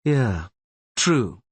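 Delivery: a hint of brusque impatience. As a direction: bite the words off briefly. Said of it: "he said brusquely".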